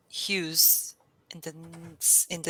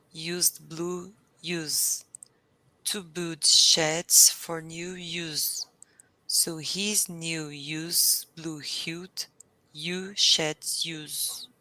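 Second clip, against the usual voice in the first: slow careful reading; slower than usual. Shown as a demonstration. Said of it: "reading a tongue twister".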